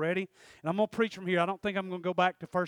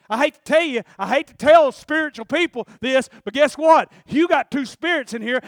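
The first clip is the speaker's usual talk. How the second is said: louder, higher, driving sermon voice; full-force preaching; 6 semitones higher, 14 dB louder than usual. Acted. Preacher warning the church, at full force. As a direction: preach it hard to the congregation.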